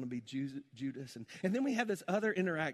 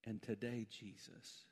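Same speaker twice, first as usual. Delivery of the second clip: low, soft praying voice with long pauses; hushed prayer; 6 semitones lower, 7 dB quieter than usual. Real, not acted. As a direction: pray quietly and reverently, leaving space between phrases. Leads closing prayer.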